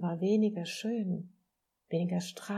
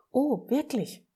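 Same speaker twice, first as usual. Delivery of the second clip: higher, louder burst of wonder; astonished question; 6 semitones higher, 6 dB louder than usual. A narrator's reading. Little soul hears the friendly soul's offer, astonished. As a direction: exclaim it in amazed surprise.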